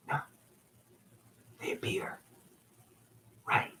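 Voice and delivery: breathy whisper, almost no voice. Real, not acted. Telling viewers about orbs in a secretive whisper.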